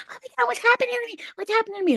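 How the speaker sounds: very high, rapid squeaky panic; panicked little elf. Retold or imitated. Elf watching its head grow big, high frantic squeal. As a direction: squeal in mock alarm as a tiny elf. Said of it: "turned into a little elf".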